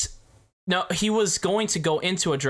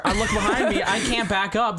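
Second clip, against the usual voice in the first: higher voice, laughing through the words; 6 semitones higher than usual. Real, not acted. Driver tells his co-host about the crash, laughing. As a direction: laugh while recalling the panic.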